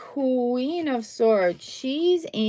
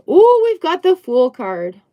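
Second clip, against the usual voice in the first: much louder, higher ooh; excited; 7 semitones higher, 10 dB louder than usual. Real, not acted. Reader delighted to reveal the Fool card.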